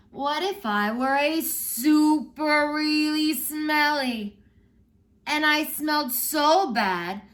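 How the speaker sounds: put-on high, loud, slow pleading little kid; child's voice. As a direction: whine it out like an eager child begging mama.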